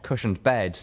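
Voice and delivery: plain reading voice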